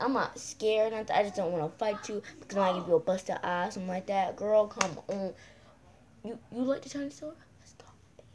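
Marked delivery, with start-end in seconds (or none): 6.2-8.3 whispering. Acted. Whispering a sly invitation.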